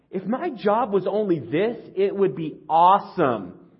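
high, loud, slow dreamy gush; excited wish. Retold or imitated. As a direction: gush about the dream job. Voiced wishful thought.

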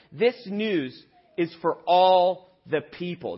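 slow, drawn-out emphasis on all; slow emphatic. Acted. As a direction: spell it out slowly for the kids. Stressing the message to kids.

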